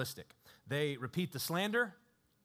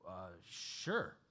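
much lower, soft, dopey uh, sure; dopey tribune; 9 semitones lower, 8 dB quieter than usual. Retold or imitated. Tribune carelessly granting Paul's request, low and dopey. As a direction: shrug it off like a dim official. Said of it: "the tribune says".

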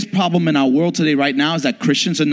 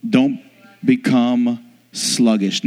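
ordinary talk

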